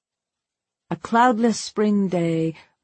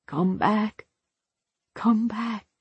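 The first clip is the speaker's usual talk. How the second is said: small, pleading repetitions, getting softer; hushed begging; 4 semitones higher, 3 dB quieter, slower than usual. A narrator's reading. Man clutching his brother's ankle, begging softly. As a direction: beg quietly, pitch lifted. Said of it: "he begged".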